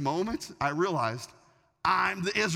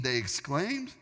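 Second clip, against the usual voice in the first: usual speaking voice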